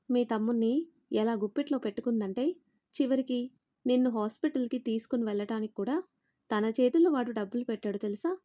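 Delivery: plain reading voice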